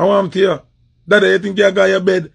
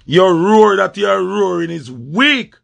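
ordinary talk

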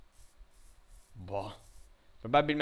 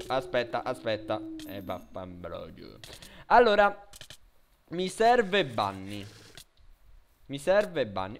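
ordinary delivery

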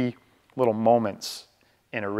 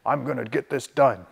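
assertive voice. Retold or imitated. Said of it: "There's a third one called the assertive voice".

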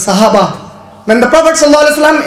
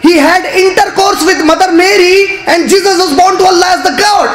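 raised, ringing sermon cry; impassioned preacher; fiery preaching; 5 semitones higher than usual. Real, not acted. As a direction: drive the point home from the pulpit. Preaching at full force.

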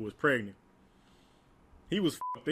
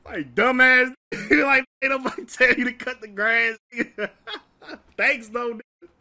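laughing mockery; 9 semitones higher, 8 dB louder than usual. Real, not acted. Mocking Willie, laughing.

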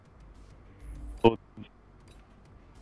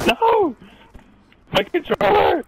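panicked yell; 6 dB louder than usual. Real, not acted. Controller dies mid-fight.

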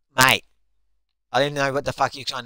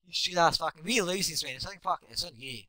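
ordinary delivery

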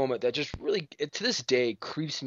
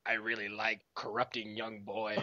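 creepy villain voice; 6 semitones lower, 8 dB quieter than usual. Pretend. Mock speed-dating Emperor answer.

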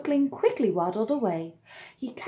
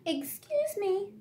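brave; 10 semitones higher, 3 dB quieter, slower than usual. A narrator's reading. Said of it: "began bravely".

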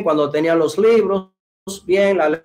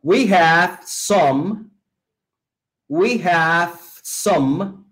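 "We have some" very slowly, word by word, final M held; slow model; much slower than usual. Shown as a demonstration. English teacher giving students a phrase to repeat, very slow model. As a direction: stretch out "We have some" with gaps for learners. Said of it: "listen and repeat".

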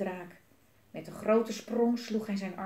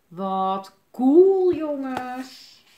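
loud, drawn-out exclamation of wonder; awed excitement; 5 semitones higher, 13 dB louder, much slower than usual. A narrator's reading. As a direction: exclaim loudly, letting it fall away. Children marvel at view while flying.

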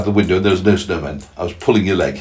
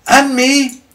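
high, loud spider-lady voice; Miss Spider; 5 dB louder than usual. Acted. Miss Spider chiming in, high and loud. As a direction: pipe up high and keen. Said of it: "Miss Spider said".

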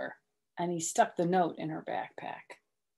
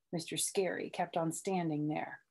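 usual speaking voice

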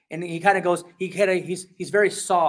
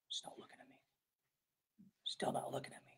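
whispered aside; 5 semitones lower, 23 dB quieter, faster than usual. Pretend. Parent whispering that the child still won't look. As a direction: hush it like a private aside.

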